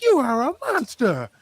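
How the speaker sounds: high, put-on outraged voice; mock scolding wife. Host imagines wife scolding husband, mock outrage. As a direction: voice her horror, hammed-up. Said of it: "you would be like".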